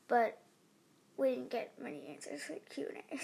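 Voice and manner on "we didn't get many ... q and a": whispering. Real, not acted. Whispered aside.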